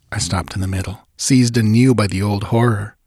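ordinary delivery

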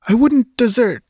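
put-on high, proud young woman; girl's voice. Girl protesting to Conan, high young woman's voice. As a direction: start a protest, then cut off.

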